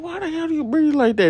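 high, puzzled retort; imitating disbelief; mock disbelief.

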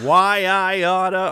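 put-on slow, loud old-timey why I oughta; cartoon threat. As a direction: play a blustering cartoon tough guy.

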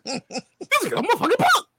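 hammed-up street beef, high and rising; mock tough guy. Pretending to pick a fight with his cohost, mock angry. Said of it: "Challenge me like I won't".

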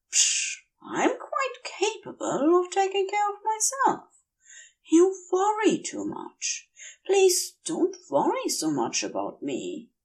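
a woozy angel soothing his friend; tipsy angel, reassuring. Acted.

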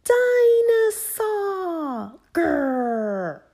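put-on very high, loud toddler shout with a drawn-out growl; George. A narrator's reading. George saying his one word, proud toddler roar. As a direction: play a tiny boy roaring his favourite word. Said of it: "he could say one word very well".

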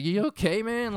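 high, loud you okay man; shaken concern. Retold or imitated. James asks his friend if he's okay, high and loud. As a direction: check on him, still rattled. Checking on friend after scare.

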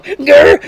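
loud playful growl; mock growl. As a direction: growl like an angry dog.

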